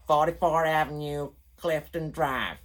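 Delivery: playfully imitating an American reading out an address in an American accent; mock American accent. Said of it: "what's my American accent".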